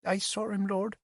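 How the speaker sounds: put-on a little girl saying it under her breath; quietly. Child admitting she saw the killer, quiet. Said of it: "she said quietly".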